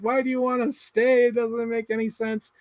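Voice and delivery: high-pitched exasperated questioning; incredulous. Retold or imitated. Viewer arguing with the film's time traveller, high incredulous protest. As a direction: question the choice in mock disbelief.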